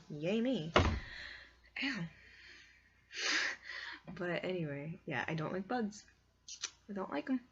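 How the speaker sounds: ordinary talk, nothing marked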